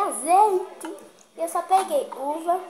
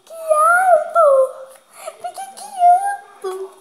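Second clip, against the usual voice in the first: much higher, louder wail; 13 semitones higher, 9 dB louder, slower than usual. Real, not acted. Kid drew okra and wails to her mother, high loud. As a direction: wail in mock despair.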